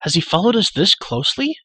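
high, loud frightened cry; startled boy crying out in the dark; alarmed cry. A narrator's reading. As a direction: blurt it out in sudden alarm. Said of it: "cried Terran".

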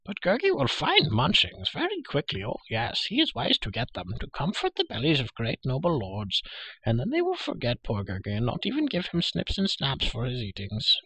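high, swooping creature voice; Gurgi, pleading. Acted. Hungry creature argues for food with his lord, pleading. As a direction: wheedle like a hungry little creature.

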